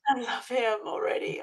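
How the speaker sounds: whispered, hushed adoration. Real, not acted. Guest whispering her love for the cat. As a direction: breathe it out softly.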